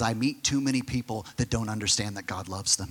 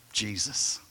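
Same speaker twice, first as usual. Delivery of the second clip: usual speaking voice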